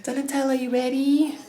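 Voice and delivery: high-pitched pet voice; cooing to dog. Real, not acted. Owner readying dog for surgery, high cooing voice.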